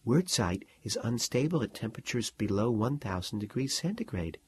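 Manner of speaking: soft, private explanation; quiet explanation whispered in class; hushed aside. A narrator's reading. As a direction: murmur the fact to your neighbour. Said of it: "Mary said".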